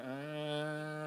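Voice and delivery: "Ah" said with no R, a soft open vowel. Retold or imitated. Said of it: "And I went".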